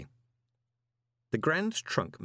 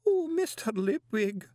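high, drawn-out wail; wailing woman; 12 semitones higher, slower than usual. A narrator's reading. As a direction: stretch it into a tearful plea. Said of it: "a wailing woman".

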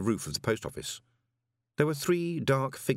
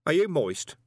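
higher, louder challenge from the dark; stern figure; 8 semitones higher, 5 dB louder than usual. Acted. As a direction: call out a hard demand.

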